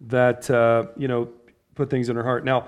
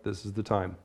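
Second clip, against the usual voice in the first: hushed mother; 8 semitones lower, 13 dB quieter than usual. Retold or imitated. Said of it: "she's like".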